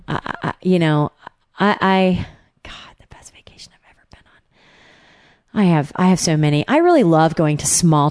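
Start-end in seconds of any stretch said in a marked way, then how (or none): 2.6-5.6 breathy whispered aside to herself, slowed down. Real, not acted. Guest whispering to herself as she searches for an answer. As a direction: drop to a whisper while thinking it over.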